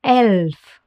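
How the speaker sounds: "Elf" with the e of bed; careful model "Elf" for a learner; German E. Shown as a demonstration. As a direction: say "Elf" in German, a short open e. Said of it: "Listen closely how it's pronounced in German".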